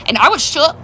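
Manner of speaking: normal talking voice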